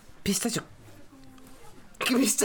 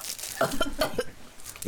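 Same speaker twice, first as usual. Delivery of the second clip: choking laugh; 5 semitones higher, 5 dB quieter than usual. Real, not acted. Choking laugh after tasting.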